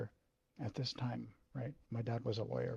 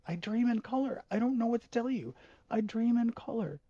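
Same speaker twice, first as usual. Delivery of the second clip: higher, louder tearful protest; tearful plea; 11 semitones higher, 5 dB louder than usual. Retold or imitated. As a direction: plead through tears. Desperate tearful denial.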